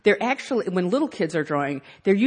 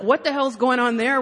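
usual speaking voice

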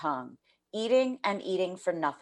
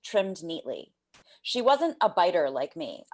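plain reading voice

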